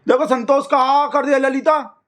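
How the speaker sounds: high-pitched exclamation of complaint; indignant call-out. Acted. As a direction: call out to someone, affronted. Calls to Lalita after hang-up.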